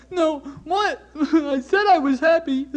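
high-pitched, hammed-up sobbing protest; mock blubbering. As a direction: wail it in a fake cry. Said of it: "you're just blubbering all over yourself and you're crying".

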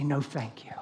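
the stranger's no, barely whispered; a hushed, gentle no thank you; whispered refusal. Retold or imitated. Imitating a stranger's soft refusal, whispered. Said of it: "probably say".

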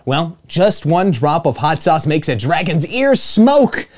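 ordinary delivery

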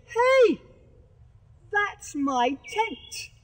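loud, high, outraged cry then slow; indignant shout. Acted. Boy yells at the Sheriff over his smashed tent, outraged shout. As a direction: yell it out, he's wrecking your tent.

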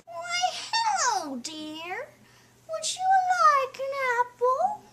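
high, slow, syrupy witch voice; sweet old witch. Acted. Witch at the door offers a poisoned apple, syrupy sweet. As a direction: coax the kid like a fairy-tale witch.

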